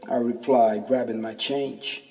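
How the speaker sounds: plain reading voice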